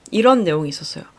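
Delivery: usual speaking voice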